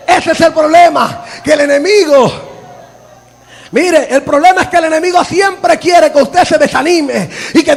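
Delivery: loud, high preaching; fervent preacher scolding the congregation; full-force preaching. Acted. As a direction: drive the point home, half laughing.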